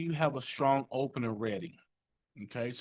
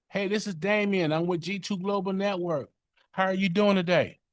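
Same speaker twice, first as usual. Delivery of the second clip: much higher and louder, bright sales opener; elevated voice; 9 semitones higher, 7 dB louder than usual. Shown as a demonstration. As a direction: lift the voice way up like an eager salesman.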